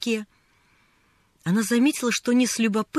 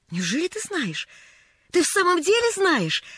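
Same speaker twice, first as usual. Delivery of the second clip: rising into higher-pitched delight; 7 semitones higher than usual. A narrator's reading. Lost girl hears the newsboy knows her street, delighted. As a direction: ask in joyful disbelief. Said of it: "обрадовалась Полианна".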